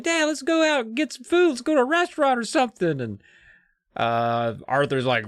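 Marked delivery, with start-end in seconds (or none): 0.0-3.0 high, hammed-up whining boy; whiny kid. Hungry son begs his dad for a restaurant, in a high whine. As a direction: beg like a hungry little kid. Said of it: "and he's like".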